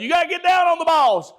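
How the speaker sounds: high, loud, fast ballfield shout; re-enacted coach hollering at a player; coach's holler. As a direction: yell instructions across the diamond. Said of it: "just like my son".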